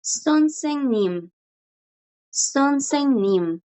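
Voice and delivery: 선생님 said slowly, syllable by syllable; slow model word. Shown as a demonstration. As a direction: model sonsennim for the class. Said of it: "teacher models word slowly".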